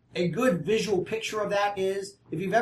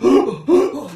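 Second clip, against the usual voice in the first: sharp sobbing gasp for breath; 6 semitones higher, 11 dB louder than usual. Shown as a demonstration. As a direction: gasp like uncontrollable weeping. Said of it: "you can't even get your breath kind of weeping".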